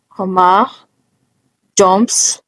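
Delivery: normal talking voice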